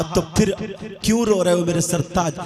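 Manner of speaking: loud sermon with chanted, sung lines; preacher chanting the husband's lament and the wife's comfort to the women; full-force preaching. Acted. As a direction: preach it, half singing the pleas.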